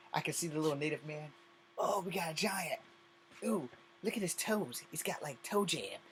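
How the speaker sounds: high, hushed little voice in awe; tiny native man. Pretend. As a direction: squeak like a tiny man gazing up at a giant.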